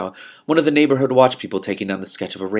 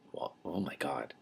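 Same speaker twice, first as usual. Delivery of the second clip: stunned aside; 5 semitones lower, 17 dB quieter than usual. Real, not acted. Reader reacts to the story.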